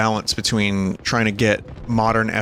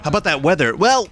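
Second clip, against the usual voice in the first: cheesy radio host building up the hype; 4 semitones higher than usual. Pretend. Parody morning radio show banter.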